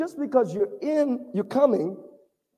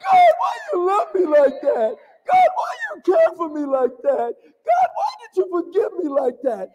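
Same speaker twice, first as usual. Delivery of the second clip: higher, louder, voice breaking with tears; tearful crying out; 6 semitones higher, 3 dB louder than usual. Retold or imitated. Believer crying out to God in tearful gratitude, higher and louder. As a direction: cry out to God overwhelmed.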